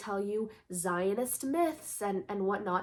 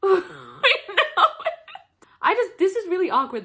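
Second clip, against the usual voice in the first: laughing into sobs; 9 semitones higher, 9 dB louder than usual. Real, not acted. Laughter tipping into crying.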